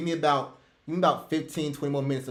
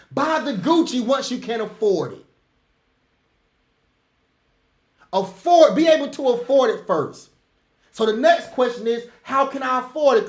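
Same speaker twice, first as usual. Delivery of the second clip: heated rant; 4 semitones higher than usual. Real, not acted. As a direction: fire them up, shouting the point home. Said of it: "Sorry for that rant".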